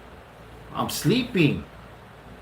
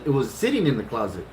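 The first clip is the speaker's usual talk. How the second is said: usual speaking voice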